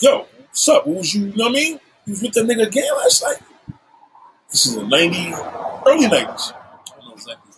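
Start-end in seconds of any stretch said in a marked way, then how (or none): 0.0-3.4 high-pitched, challenging questioning; retold confrontation. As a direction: replay confronting an ex, pitch pushed up.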